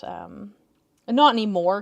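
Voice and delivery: ordinary talk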